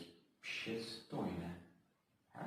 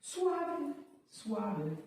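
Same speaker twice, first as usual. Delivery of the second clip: high squeak; 5 dB louder than usual. Pretend.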